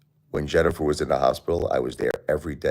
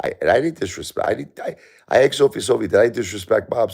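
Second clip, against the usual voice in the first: heated protest; 7 semitones higher, 5 dB louder than usual. Real, not acted. Heated retelling, pitch spike.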